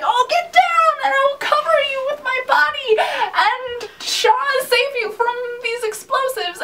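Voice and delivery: high, far-off mock-heroic cry; swooning fan quoting the deputy's heroic line; heroic deputy quote. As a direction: belt it like a self-sacrificing hero. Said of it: "it's just like".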